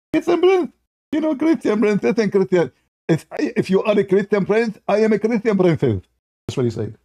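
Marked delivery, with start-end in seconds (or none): 0.1-2.7 mock girl voice, much higher; girly voice. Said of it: "you start speaking like a girl". 3.1-6.0 mock girl voice, higher; girly voice. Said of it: "you start speaking like a girl".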